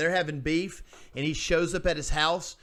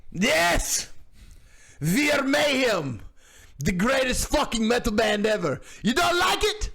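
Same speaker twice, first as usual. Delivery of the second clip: shouty caricature of the band member; angry metal singer; 8 semitones higher, slower than usual. Retold or imitated. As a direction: bark it at the interviewer like a furious rocker. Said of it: "The guy's like".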